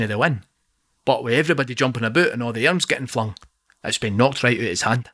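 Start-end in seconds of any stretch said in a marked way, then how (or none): none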